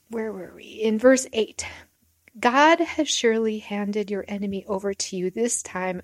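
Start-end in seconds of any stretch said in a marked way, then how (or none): none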